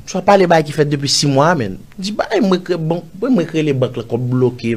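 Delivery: high, loud re-enacted protest; retold indignant outburst. Man retelling his reply to a money demand, indignant. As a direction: protest in disbelief, pitched well up and loud.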